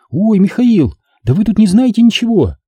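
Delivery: put-on high girlish voice, bursting with news; girl's amazed exclamation. A narrator's reading. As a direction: exclaim high at his ignorance. Girl amazed he has no news.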